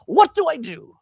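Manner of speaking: a scared man's plea; frightened plea. Acted. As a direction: beg for an answer in fear.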